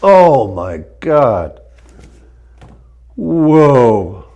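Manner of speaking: slow, loud gasp of amazement; drawn-out, overwhelmed oh my God; stunned awe. Real, not acted. First Y strap adjustment lands.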